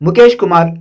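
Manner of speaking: usual speaking voice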